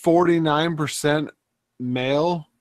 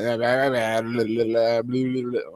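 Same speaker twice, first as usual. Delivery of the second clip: mock babble; 3 semitones lower, 4 dB quieter than usual. Mocking the wife's chatter.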